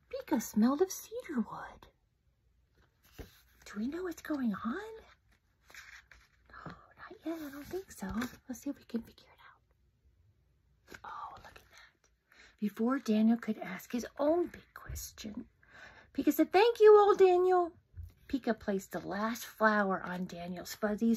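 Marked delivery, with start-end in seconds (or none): 3.6-12.1 soft conspiratorial whisper; hushed whisper. Acted. Reader whispering guesses to the listening children. 16.5-17.7 high, loud cheerful thanks; bright little bunny voice. Acted. Pika the bunny thanking the old bear happily.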